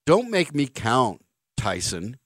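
usual speaking voice

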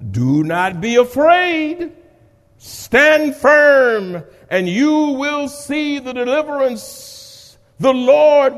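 rally the people; stirring pulpit call; preaching at full force. Acted. Preaching Moses' words.

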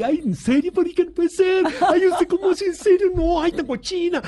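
very high squealing female voice; mock girly gossip. Actresses gossiping about their tastes, mock girly squeal. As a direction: squeal like giggling girlfriends. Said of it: "Como mujeres".

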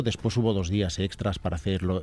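normal talking voice

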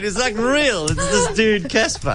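ordinary talk

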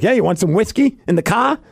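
a hint of a Boston dude; Boston accent. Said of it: "all of a sudden he's from Boston".

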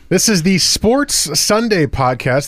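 loud radio announcer; announcer read. Real, not acted. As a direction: read the sponsor like a DJ. Radio host reads the sponsor.

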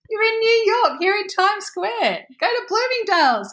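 high, eager encouragement; excited urging. Retold or imitated. The shop girls telling her to go shopping, excited. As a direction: urge her on, thrilled for her.

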